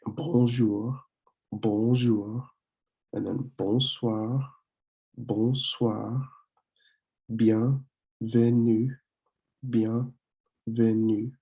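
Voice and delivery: bonjour, bonsoir, bienvenue each said twice, slow and clear with a rise; slow French pronunciation drill; teacher repeat drill. Shown as a demonstration. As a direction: model each word slowly for the class. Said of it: "So when we say these again".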